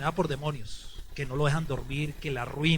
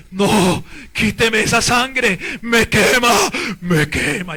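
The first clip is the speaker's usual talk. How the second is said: loud, drawn-out demonic protest; imitated devil; 3 semitones higher, 9 dB louder, much slower than usual. Priest imitating the devil facing the Santísimo, demonic wail. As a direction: cry out like a demon being burned. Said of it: "el diablo me dice así".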